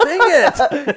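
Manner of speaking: loud high laughing dang it; mock-annoyed, laughing hard; laughing mock frustration.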